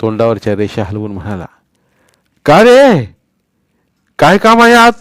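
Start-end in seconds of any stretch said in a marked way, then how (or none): 2.5-4.9 put-on loud, high old man's call; old man asking. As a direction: ask the boys like a gruff grandpa.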